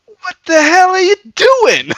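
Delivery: high, loud, drawn-out mock shriek; hammed-up indignant cry; imitated outraged maid. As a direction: wail it in scandalized disbelief. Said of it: "the way she delivers that line".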